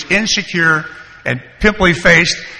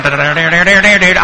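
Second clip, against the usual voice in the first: quick sung-out banjo riff; mouthed banjo lick; imitated banjo; 5 dB louder than usual. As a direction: mimic a twanging banjo with da-da syllables. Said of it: "I can hear that banjo going".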